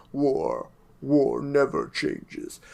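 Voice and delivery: slow, lightly voiced narrator line; mock narrator. Quoting the Fallout intro to friends, lightly voiced narrator. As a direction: intone it slowly like a game narrator. Quotes the game's narrator, slowly.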